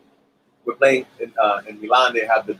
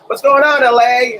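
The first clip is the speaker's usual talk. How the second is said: mock stage greeting; 14 semitones higher, 7 dB louder than usual. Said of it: "you went".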